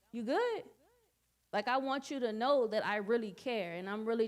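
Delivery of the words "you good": caring check-in. Shown as a demonstration.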